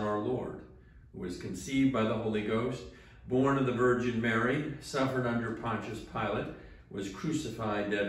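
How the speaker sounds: ordinary talk, nothing marked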